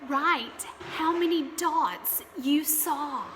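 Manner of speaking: ordinary talk, nothing marked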